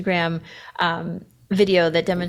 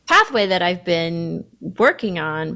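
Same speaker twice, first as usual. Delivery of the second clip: ordinary talk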